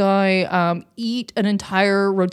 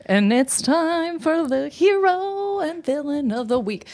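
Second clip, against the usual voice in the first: slow, higher drawn-out announcer voice; segment announcer; 6 semitones higher than usual. Pretend. Podcast host introduces hero and villain segment, mock announcer.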